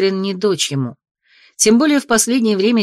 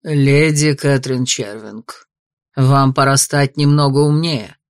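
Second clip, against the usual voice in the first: low, slow and icy; cold; 6 semitones lower, slower than usual. Acted. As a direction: say it coldly, word by word. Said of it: "холодно произнёс лорд".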